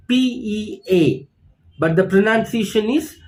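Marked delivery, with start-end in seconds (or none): 0.1-1.3 teacher spells pea on the board; spelling it out. Shown as a demonstration. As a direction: spell out P E A letter by letter. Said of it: "Look at the spelling".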